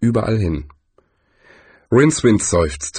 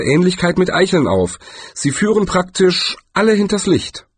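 higher, hurried know-it-all bluff; bluffing wizard; 7 semitones higher than usual. Acted. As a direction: bluff your way through with a raised voice. Rincewind bluffs about beechnuts.

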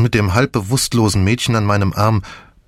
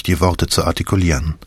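ordinary delivery, nothing marked

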